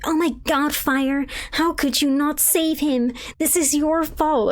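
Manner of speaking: high, slow, melodramatic reproach; mock accusing lament. Mimics Sophia blaming the player.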